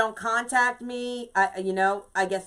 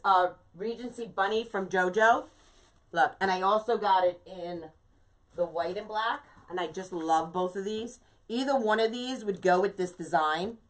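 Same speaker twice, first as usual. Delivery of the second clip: usual speaking voice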